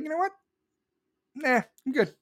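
high, offhand brush-off; mock casual refusal. Cops answering demands for help, high offhand mock refusal. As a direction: imitate someone breezily declining.

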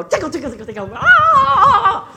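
loud burst rising to a high squeal; playful tickle attack. Retold or imitated. Dad turns over and tickles his children, loud squealing excitement. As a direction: pounce with it, then shriek.

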